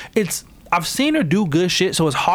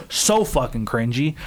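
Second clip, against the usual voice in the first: normal talking voice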